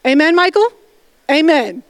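usual speaking voice

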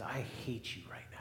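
put-on soft, stinging whisper of a child; hushed whisper. Preacher quoting an angry little child, whispered.

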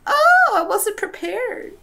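high, slow mock-wounded voice; hammed-up offended falsetto; mock hurt squeak. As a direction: squeal it as if stung, drawn out. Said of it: "But I'm like".

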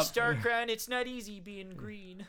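high, slow puppet-frog impression; sing-song Kermit the Frog line. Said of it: "crossover with the Muppets".

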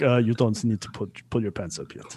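lightly voiced Russian doctor, dropping off softly; a hint of a Russian accent; rough Russian accent. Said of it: "a really good Russian accent".

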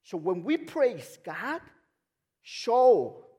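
loud, high, emphatic pulpit delivery; full-force preaching. Real, not acted. Preacher asking church if God is faithful, loud and fervent. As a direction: preach it out big, stretching key words.

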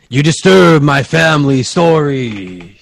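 slow, echoing ghostly boom; a haunted painting's booming warning; booming crazy voice. Acted. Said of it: "You heard a bo-, booming".

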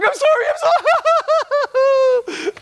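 very high, slow, loud laughing; laughing apology. Retold or imitated. Man laughing during the sermon apologising to the room, loud. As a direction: apologise while laughing uncontrollably. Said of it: "I was like".

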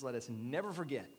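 ordinary talk, nothing marked

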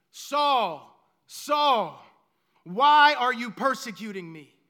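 loud calling out from the light. Acted. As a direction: call his name out loud. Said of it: "a voice calls out".

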